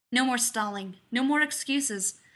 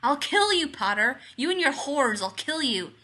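loud, high-pitched furious threat falling at the end; angry roar; 4 semitones higher, 6 dB louder than usual. A narrator's reading. Tom roaring threats at Harry as his spell fails, furious. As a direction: roar it out in rage. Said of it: "He roars".

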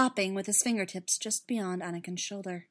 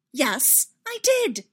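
loud, pitch leaping on the second phrase; emphatic, flustered confession; defiant admission; 8 semitones higher, 8 dB louder, slower than usual. Acted. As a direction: admit it head-on, voice raised.

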